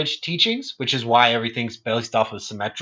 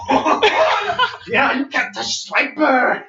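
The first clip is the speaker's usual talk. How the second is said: high, slow taunting caricature; pitched-up gloat, drawn out; Swiper; 12 semitones higher, much slower than usual. Acted. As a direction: act out a cocky fox dodging spikes. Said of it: "I'm gonna act it out".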